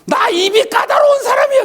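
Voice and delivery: very high, hammed-up indignant customer yell; mock furious shout. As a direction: shout it like a self-important angry diner. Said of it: "굉장히 화를 내더래요". (까다로운 손님 흉내로 버럭 화내듯)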